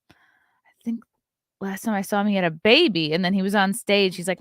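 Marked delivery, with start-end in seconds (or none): 0.1-1.0 whisper. Real, not acted. Whispered aside.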